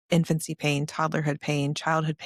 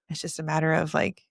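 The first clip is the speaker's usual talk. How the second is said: ordinary talk, nothing marked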